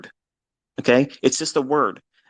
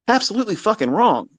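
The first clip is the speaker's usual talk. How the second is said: exasperated outburst; 10 semitones higher than usual. Real, not acted. Angry outburst at old mentor.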